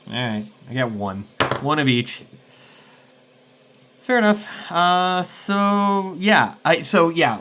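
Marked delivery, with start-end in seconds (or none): 4.0-7.4 louder, higher burst of reaction; animated reaction. Real, not acted. Player reacting to the GM's ruling, louder and higher. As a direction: react big and bright.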